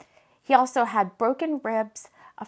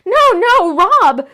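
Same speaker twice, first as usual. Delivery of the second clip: loud, high scream of denial; 9 semitones higher, 14 dB louder, much slower than usual. Acted. Jade reading the headline about Rob, screaming. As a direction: scream it as the news hits. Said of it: "she screamed out".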